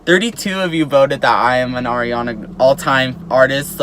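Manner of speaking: ordinary delivery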